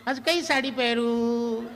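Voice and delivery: high, slow call from the bedroom, with a laugh; wife calling out. Retold or imitated. Wife calls from bedroom to shaving husband, drawn-out high call. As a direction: sing it out like a wife calling from another room. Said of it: "બેડરૂમમાંથી બૂમ પાડે".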